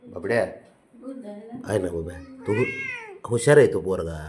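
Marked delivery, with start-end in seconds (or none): 0.0-2.7 slow, low and soft coo. Real, not acted. Owner cooing to the pet cat, slow and soft. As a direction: coax the cat gently, drawing it out.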